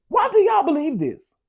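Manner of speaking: high, incredulous squeal; exasperated disbelief. Retold or imitated. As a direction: ask it in shocked disbelief. Repeating the quote, higher.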